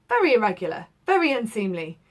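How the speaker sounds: lightly voiced peevish scolding; a touch of fussy irritation. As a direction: lawyer mildly scolding the gathered servants. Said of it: "said the lawyer peevishly".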